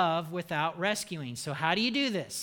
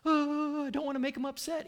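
mock timid pleaser; 6 semitones higher, faster than usual. Mocking timid people-pleaser.

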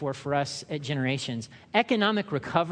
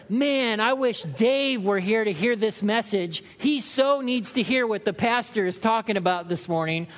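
higher, louder pious sigh; mock self-righteous wish; 5 semitones higher, 3 dB louder than usual. Churchgoer wishing others heard the sermon. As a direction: sound smugly wishful. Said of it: "we do the thing where".

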